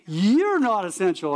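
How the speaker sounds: sarcastic mock hello, then a slow, deliberate put-down; mock retort. Pastor mocking officials who called church nonessential, sarcastic. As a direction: fire back sarcastically at the critics. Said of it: "We're like".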